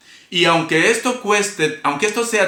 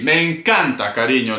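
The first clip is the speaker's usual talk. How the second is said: ordinary talk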